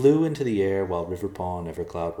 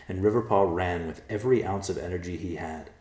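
usual speaking voice

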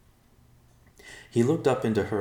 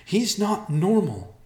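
character voice; 14 semitones higher, 6 dB louder, slower than usual. Acted. Character voice in fiction.

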